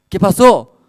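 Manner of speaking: loud, high, urgent question; mock alarm at a crying child; alarmed father. Acted father asking crying daughter.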